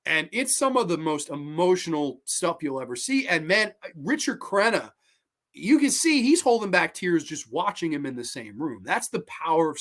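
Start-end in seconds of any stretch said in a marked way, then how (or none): none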